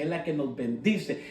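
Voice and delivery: usual speaking voice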